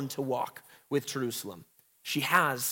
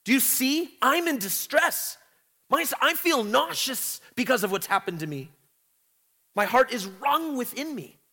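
anguished prayer; 7 semitones higher than usual. Retold or imitated. Teacher voicing Zion crying out to God, anguished. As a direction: plead with God in distress. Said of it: "This is a prayer".

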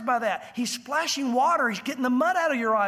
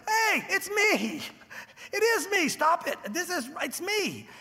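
high-pitched mock protest; hammed-up indignant insistence; exasperated insisting; 8 semitones higher, slower than usual. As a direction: plead to be recognised, drawing out the key words. Healed man insisting to the doubters.

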